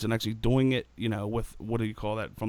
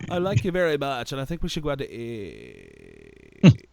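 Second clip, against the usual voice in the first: vocal fry; slower than usual. Shown as a demonstration. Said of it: "Vocal Fry".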